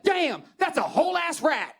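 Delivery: loud, high-pitched astonished holler; imitated stunned passer-by; amazed shout. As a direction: shout it across the street in amazement. Passer-by shouts at seeing rat.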